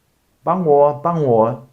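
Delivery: wrong cry for help. Pretend. Learner wrongly shouts for help while drowning. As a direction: call out 帮我 as if in trouble. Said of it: "You wouldn't use the word 帮 there".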